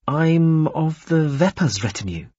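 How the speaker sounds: high, slow, uncertain answer; hesitant. A narrator's reading. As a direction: feel your way into the answer. Halting answer after a delay.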